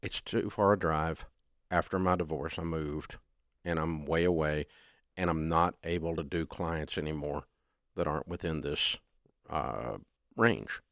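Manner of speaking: soft, low and steady; calm, quiet refusal. Shown as a demonstration. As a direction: gently decline the client. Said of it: "You just say".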